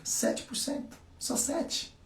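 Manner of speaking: conspiratorial whisper; stage whisper. Acted. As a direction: lean in and whisper the number like a secret. Stressing small figure to audience.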